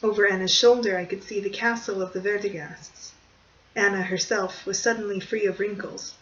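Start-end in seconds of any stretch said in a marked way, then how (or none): none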